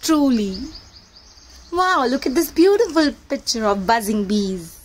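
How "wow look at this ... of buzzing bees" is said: usual speaking voice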